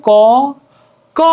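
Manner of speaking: ক with the aw vowel sounded; ক with its built-in vowel held clear; inherent vowel demo. Shown as a demonstration. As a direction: say ক as "kaw", standing alone. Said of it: "সরিয়টা অ উচ্চারণ হচ্ছে".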